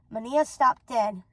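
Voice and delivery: ordinary delivery, nothing marked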